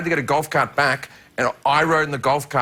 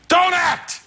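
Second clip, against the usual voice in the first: mock Pacino shout; 13 semitones higher, slower than usual. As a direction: belt it like an over-the-top Pacino. Pacino blurting career advice.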